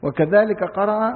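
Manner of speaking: usual speaking voice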